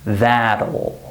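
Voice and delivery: THAT'LL pronounced "thad-ul"; THAT'LL rhyming with "saddle"; two syllables. Shown as a demonstration. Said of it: "with two syllables".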